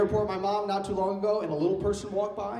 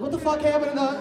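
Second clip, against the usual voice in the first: indignant; 7 semitones higher, 4 dB louder than usual. Retold or imitated. Indignant reaction to mom.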